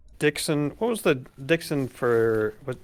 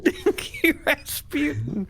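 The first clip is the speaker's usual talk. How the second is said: laughing name; 11 semitones higher, slower than usual. Pretend. Player trying out a silly character name, laughing. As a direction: say Dinky Rasputin high through laughter. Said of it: "I kinda like saying those words".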